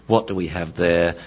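ordinary delivery

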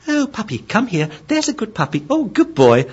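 very high, coaxing puppy-praise voice; sweet puppy talk. Acted. Owner training a puppy sweetly, high coaxing voice.

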